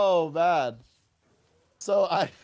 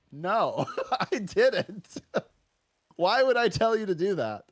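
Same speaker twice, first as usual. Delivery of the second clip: higher-pitched retort broken by laughter; laughing disbelief; 8 semitones higher, slower than usual. Retold or imitated. Teacher retelling a denial to a student, laughing in disbelief. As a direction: laugh through an incredulous denial.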